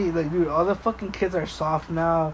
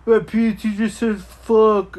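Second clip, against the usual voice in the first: mock whiny kid, stretched out slowly; hammed-up tattletale voice; whiny kid tattling; 2 semitones higher, 6 dB louder, slower than usual. Mocking kids who tattle to parents, whiny. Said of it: "fucking talking like".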